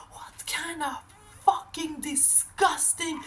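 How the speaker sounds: high, strained, hushed mock screaming; retold furious scream. Vlogger retells yelling at the park stalker, high hushed fury. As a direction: spit out the insults at the man, pitched high but held down. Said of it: "I screamed to that man".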